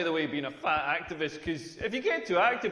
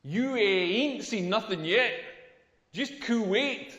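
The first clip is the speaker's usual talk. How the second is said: slow pun delivery; slower than usual. Acted. Slow punning drawl.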